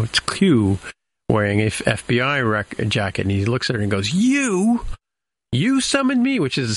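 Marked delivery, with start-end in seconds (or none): 4.1-6.4 very high, drawn-out disbelief; hammed-up astonished question, voice shooting way up; mock incredulous. Acting out Q's startled question.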